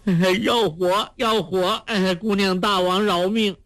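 high, frightened begging; acted high-pitched plea for mercy; pleading for life. Fat couple begs their captor to spare them, high pleading. Captives beg the heroine for mercy.

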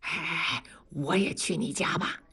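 gloating whisper to a friend; softly whispered aside. Acted. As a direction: hush it, sly and pleased. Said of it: "小声地说".

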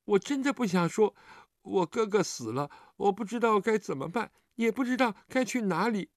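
put-on high, plaintive child voice building toward tears; aggrieved child. A narrator's reading. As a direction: plead and grieve like a wronged little kid. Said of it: "接着委委屈屈地道".

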